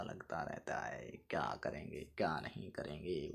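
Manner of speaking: a shrugging whisper to yourself; whispered aside. Real, not acted. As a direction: whisper it under your breath, voice almost gone. Teacher muttering a wry aside. (फुसफुसाकर कहा गया)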